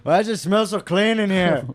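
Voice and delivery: mock complaint in a cartoon Indian accent; audience member impression. Audience member complaining about the club, in a put-on accent. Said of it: "impression of some guy who came".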